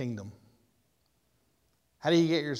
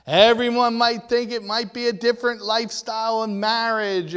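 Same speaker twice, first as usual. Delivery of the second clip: louder and higher, pressing on; preaching build; 5 semitones higher, 6 dB louder than usual. Real, not acted. Preacher listing what people chase, louder higher preaching. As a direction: build up the list with force.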